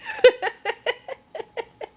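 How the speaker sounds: high, loud burst of laughter; delighted laugh. Real, not acted. Child says boogity boo.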